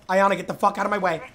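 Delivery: fast furious snap at a teammate; angry. Real, not acted. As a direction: bark it quickly, fed up. Teammate blocking him in game.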